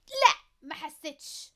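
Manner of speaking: loud, slow, firm no; emphatic. Real, not acted. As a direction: punch out the denial. Answers her own question firmly.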